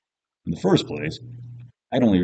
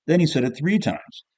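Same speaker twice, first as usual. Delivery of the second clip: plain reading voice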